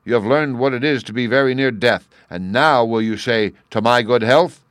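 loud, high furious demand; furious king. A narrator's reading. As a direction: spit it out in a rage. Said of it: "fell into a furious passion and said".

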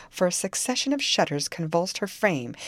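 ordinary delivery

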